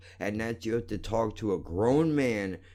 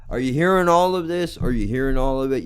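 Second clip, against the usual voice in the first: higher, louder, fast yell; angry shout; 4 semitones higher, 8 dB louder, faster than usual. Real, not acted. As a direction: demand an answer, furious. Ranting at YouTube.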